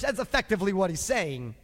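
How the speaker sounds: ordinary delivery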